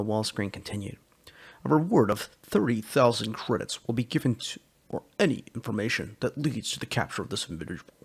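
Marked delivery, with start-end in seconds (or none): 1.6-7.9 lightly voiced official broadcast read; security announcement. Wall screen warns citizens of Hurston, official announcer tone. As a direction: announce it like a public alert.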